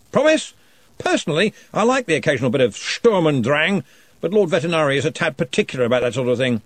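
jovial lordly wizard; booming Arch-Chancellor. A narrator's reading.

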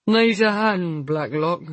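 put-on slurred drunken come-on. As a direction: slur it like a drunk man. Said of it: "slurred a voice behind me".

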